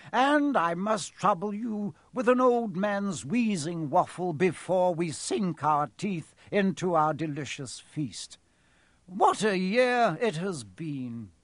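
put-on cheerful old headmaster addressing the hall; Dumbledore. A narrator's reading. Dumbledore addresses the end-of-year feast, cheerful and stately. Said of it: "Dumbledore said cheerfully".

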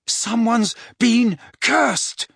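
high, drawn-out bellow over the wind. Acted. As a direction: bellow it so he hears through the storm. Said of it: "bellowed Harry".

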